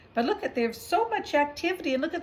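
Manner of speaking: ordinary delivery, nothing marked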